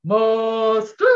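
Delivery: called out loud, rising; captain's call. Shown as a demonstration. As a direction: bellow the muster call to the crew. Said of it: "I yell".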